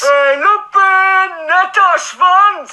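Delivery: langsam, gedehnt aus dem Fenster brüllen; über den Hof brüllen; gebrüllt. Acted. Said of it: "brüllte ohne Megafon raus". (langsames Brüllen)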